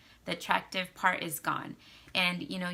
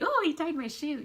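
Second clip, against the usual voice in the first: high, louder swooning delight; gushing; 9 semitones higher, 4 dB louder than usual. Retold or imitated. Wife acting out joy when husband ties her shoe, swooning. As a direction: melt over a tiny kindness.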